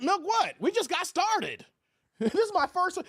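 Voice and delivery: baffled at the chat's accusation; indignant disbelief. Real, not acted. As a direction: throw the question back, offended. Reacting to a chat insult.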